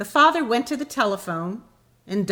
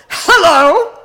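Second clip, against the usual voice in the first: a much louder, higher roar of a greeting; roared hello; 10 semitones higher, 15 dB louder than usual. Retold or imitated. Stranger roaring at yet another call from the father, furious. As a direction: bark it down the phone like a furious man. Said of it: "the controlled but violent voice roared".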